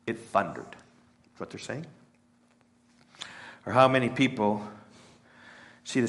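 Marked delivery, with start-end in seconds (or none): 0.1-0.7 dismissive. Retold or imitated. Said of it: "And they said". 0.7-1.9 whispered aside; preacher whispering the point. Real, not acted.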